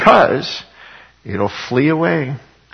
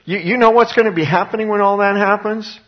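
much higher sarcastic challenge; sarcastic retort; 10 semitones higher, 3 dB louder than usual. Real, not acted. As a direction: needle them with disbelief. Preacher retorts to the scoffers.

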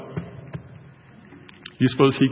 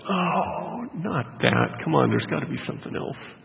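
whiny, higher-pitched reluctant plea; hammed-up groan of reluctance; mock pleading; 6 semitones higher than usual. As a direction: plead like you're dreading it. Man pleading against the task.

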